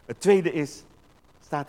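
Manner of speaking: ordinary talk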